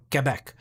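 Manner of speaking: French-style "Keh-bec", no w; Quebec with a "keh" start. Retold or imitated. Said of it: "Okay, I'm sorry".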